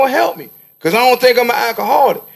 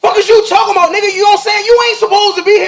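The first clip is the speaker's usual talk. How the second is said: heated shout; 7 semitones higher, faster than usual. Real, not acted. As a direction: let it rip, furious and laughing in disbelief.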